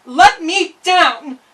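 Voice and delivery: high, loud, slow desperate scream; a girl screaming to be freed. Acted. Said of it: "screamed the girl".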